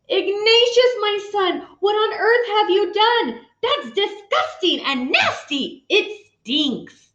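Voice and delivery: high-pitched disgusted mom; appalled mother. Acted. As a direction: shriek it like a mother seeing dirt. Mother discovers dirty diapers.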